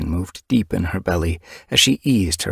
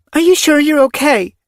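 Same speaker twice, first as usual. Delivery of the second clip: anxious; 8 dB louder than usual. Acted. Anxious mother.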